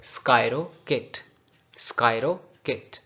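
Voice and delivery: स्कायरो किट with sky and ro run together, kit split off; linked pronunciation. Shown as a demonstration. Teacher drilling linked pronunciation for the class. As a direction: link स्कायरो then say किट. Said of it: "तोड़ लें लफ्ज़ों को".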